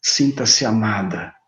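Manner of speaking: tender ear-whisper of love; soft whisper in the ear. Shown as a demonstration. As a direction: breathe it gently into their ear. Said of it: "naquele tom de voz bem baixinho no ouvido".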